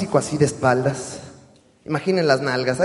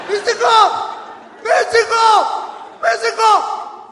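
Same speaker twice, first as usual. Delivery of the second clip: gritos agudos y alargados; drawn-out shout; 10 dB louder, much slower than usual. Retold or imitated. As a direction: estira el nombre como llamándolo de lejos. Imitating fan yelling at wrestler. (el señor sigue gritándole a Místico, alargando el grito)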